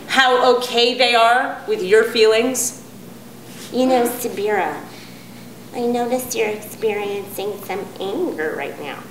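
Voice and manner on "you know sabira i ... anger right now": slow, soft, overly calm condescension; patronizing spiritual type. Retold or imitated. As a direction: soothe her with smug gentleness. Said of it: "people who like to tell you".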